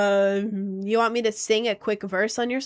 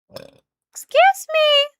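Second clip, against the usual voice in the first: startled squeak; 8 dB louder, much slower than usual. Real, not acted. Sudden high squeak mid-stream.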